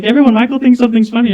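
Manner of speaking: high, loud, rushed call-out; public announcement. Retold or imitated. Coworker exposing him to everyone, mock loud announcement. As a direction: call it out fast to the whole room.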